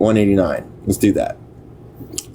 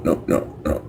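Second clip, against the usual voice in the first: breathy whisper, much softer; 7 semitones lower, 7 dB quieter than usual. Real, not acted. Streamer losing her page, whispering to herself. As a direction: whisper the no's to yourself under your breath.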